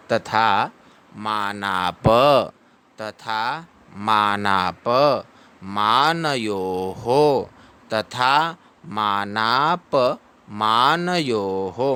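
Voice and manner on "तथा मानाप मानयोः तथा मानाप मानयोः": drawn-out teaching recitation; slow recitation. Shown as a demonstration. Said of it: "आगे आएगा द्वितीय चरण में".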